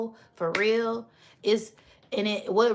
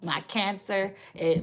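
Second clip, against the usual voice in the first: usual speaking voice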